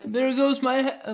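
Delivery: silly chicken voice. Acted. Voicing the chicken losing its head.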